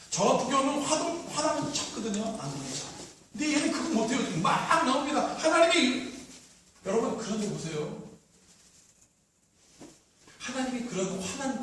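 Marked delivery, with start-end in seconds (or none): none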